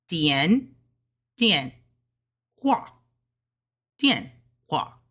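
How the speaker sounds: 分开读的第四声; separate fourth tones. Shown as a demonstration. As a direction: show the wrong separated tones. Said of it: "But you wouldn't say it like that together".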